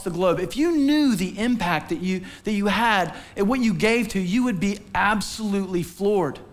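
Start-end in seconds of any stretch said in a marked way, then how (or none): none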